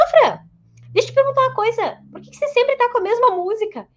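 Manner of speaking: put-on high, loud teen calling out; curious teenager. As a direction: call out like a cheeky teen. Teen patient calls out question.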